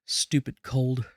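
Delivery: usual speaking voice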